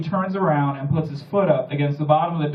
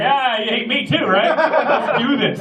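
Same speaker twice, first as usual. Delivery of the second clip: higher, laughing through the teasing reply; laughing mock retort; 6 semitones higher, slower than usual. Comedian mocking a hater, laughing. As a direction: tease them back while cracking up. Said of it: "I'm like".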